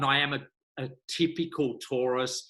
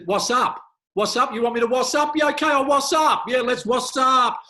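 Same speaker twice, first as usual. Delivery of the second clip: much higher and louder, building to a shout; mock excited; 11 semitones higher, 9 dB louder than usual. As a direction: play it as cheerful over-the-top enthusiasm. Mishears wash up, mock excitement.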